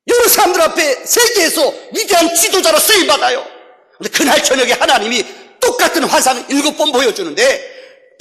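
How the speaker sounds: high, loud storytelling from the pulpit; rousing preaching. Acted. Preacher telling of the pastor's hopes before release, rousing high delivery. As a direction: build to a ringing shout. Builds sermon story at force.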